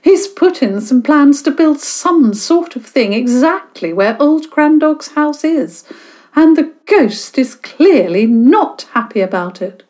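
high, theatrical spooky narration; dramatic storyteller. Acted. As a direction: ham up the ghost story. Alice continues her complaint.

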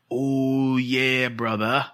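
drawn-out wrestler's catchphrase; Macho Man. Pretend. Host trying an English version for the co-host, drawn-out wrestler impression. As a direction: stretch it out like a pro-wrestling showman. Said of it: "I think people like your Macho Man".

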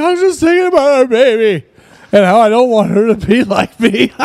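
high-pitched mock wailing; bawling. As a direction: act out a tearful confession, breaking into laughter. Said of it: "and I was fucking bawling".

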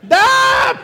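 high, loud scream; mock panicked scream. Trapped MRI patient panicking, loud high scream. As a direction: let out a comic shriek of panic.

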